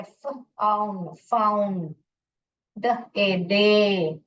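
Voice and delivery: फाउंडेशन broken into separate sounds with pauses; syllable by syllable. Shown as a demonstration. Teacher breaking फाउंडेशन into its three syllables, sounded out separately. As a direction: sound out फाउंडेशन piece by piece, "फाउन", "डे", "शन". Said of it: "वो क्या क्या है?".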